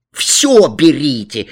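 put-on emphatic childish shout; boy shouting. A narrator's reading. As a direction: call it out, stretching the words. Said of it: "закричал Алян".

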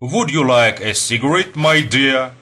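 put-on English offer in an American accent; light American accent. A narrator's reading. American offers the seated young man a cigarette, American accent. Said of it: "с лёгким американским акцентом".

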